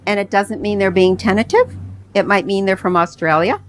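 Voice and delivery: ordinary talk, nothing marked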